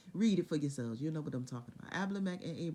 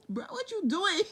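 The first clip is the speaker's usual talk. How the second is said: much higher, laughing through the words; laughing mock God; 13 semitones higher, 5 dB louder than usual. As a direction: giggle the question out.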